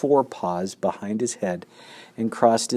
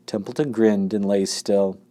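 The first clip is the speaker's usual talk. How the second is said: plain reading voice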